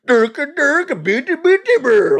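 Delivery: high, loud mock gibberish; mocking drama channels; gossip gibberish. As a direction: babble like a hyped commentator. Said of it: "like, uh".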